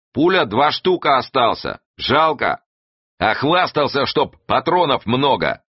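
high, loud-voiced complaint; calling back. A narrator's reading. Shouted exchange about the last two bullets, calling out. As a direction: call it out high across the boat.

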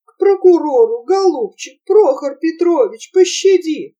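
father mocking his son with fake terror; mock pleading. A narrator's reading. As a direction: ham up a frightened plea. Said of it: "маска на его лице, испуг с мольбой".

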